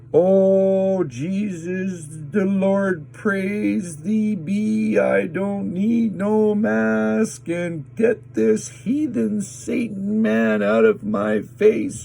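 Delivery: loud, high, slow shrill-ish sermon; mock loud preaching. Imitating the anti-mask lady yelling at him, loud and high. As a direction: ham up a zealot yelling scripture. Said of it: "screaming ... speaking loudly at the top of her lungs".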